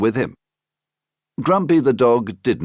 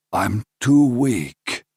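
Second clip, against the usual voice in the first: weak king; 4 semitones higher, slower than usual. Acted. Sick king speaking.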